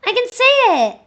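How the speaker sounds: loud, high gleeful cry; proud exclamation. Real, not acted. Streamer proudly telling chat she can say it. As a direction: announce it proudly.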